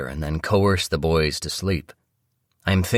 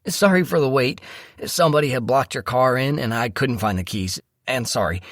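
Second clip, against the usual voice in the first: high-pitched, rushed-in valet voice; apologetic valet; 8 semitones higher than usual. Acted. Valet apologizing to waiting couple, flustered.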